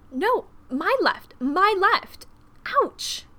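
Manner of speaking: high, loud, drawn-out correction; frustrated dancer shouting slowly; exasperated shout. A narrator's reading. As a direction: yell the instruction, then yelp. Dance practice, calling out in pain.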